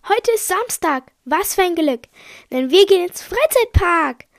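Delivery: high-pitched, gleeful announcement; excited delight. Acted. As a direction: bubble over with happy anticipation, pitched well up. Narrator delighted about park trip.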